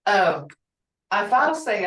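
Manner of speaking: ordinary talk, nothing marked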